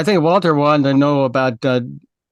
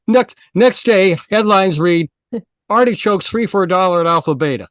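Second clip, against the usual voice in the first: punchline delivery; 6 semitones higher, 3 dB louder than usual. Acted. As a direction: land the punchline big. Punchline restarted bigger.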